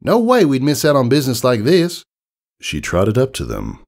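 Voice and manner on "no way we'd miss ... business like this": put-on high, louder Southern farm girl; Southern country mare. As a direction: give it a down-home Southern twang. Applejack voiced in story.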